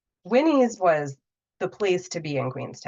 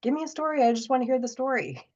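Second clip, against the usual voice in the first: eager listener; 7 semitones higher, faster than usual. Retold or imitated. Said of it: "They love".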